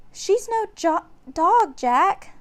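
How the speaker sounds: put-on young girl protesting her brother; little sister. A narrator's reading. Annie correcting Jack, high young girl's protest. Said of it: "said Annie".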